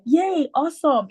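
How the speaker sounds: high, cheering retold reaction; excited. Daughter cheering at dad's university news, excited. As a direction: imitate your own happy cheer at the news. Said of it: "I was excited about it".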